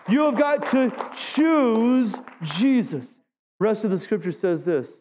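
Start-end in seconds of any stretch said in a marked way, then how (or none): 0.1-3.1 very slow, stretched "have got to choose"; pleading slowly, word by word; drawn-out plea. Shown as a demonstration. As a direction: drag every word of the plea. Repeated plea, slower.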